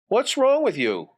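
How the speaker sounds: high-pitched, pestering sister; needling sister. Acted. Sister interrupts Johnny in the den, needling. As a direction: pitch it up like a nosy sibling.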